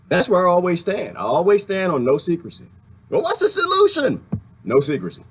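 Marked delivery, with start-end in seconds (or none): 3.1-4.2 imagined heckler. Pretend.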